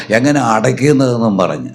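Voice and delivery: ordinary talk, nothing marked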